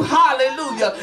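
ordinary talk

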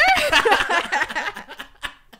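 big burst of laughter; loud laugh. Real, not acted. Laughs at the student joke.

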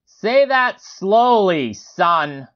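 high, drawn-out command; blared out. A narrator's reading. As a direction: call it slowly across the room. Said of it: "blared out".

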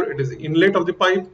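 ordinary talk

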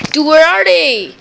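fed-up gamer whine, pushed up high; exasperated outburst. Real, not acted. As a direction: cry out in frustration at the game. Complaining loudly during game.

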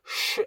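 "쉬" as a bare, whispery sh; isolated sh sound. Shown as a demonstration. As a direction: hiss "쉬" out alone to show the sound. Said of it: "쉬 발음이 납니다". ("쉬"를 성대 울림 없이 바람 소리로 발음)

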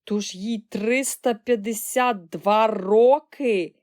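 loud, slow disbelief; amazed exclamation. A narrator's reading. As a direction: spell out the figure in amazement. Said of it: "вигукнув пан Бакет".

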